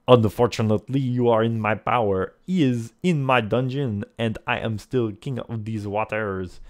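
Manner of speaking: put-on loud, boastful French accent; French-accented villain. Lafond boasts of his power, French accent. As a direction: lord it over them in a French accent. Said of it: "he asks in a light French accent".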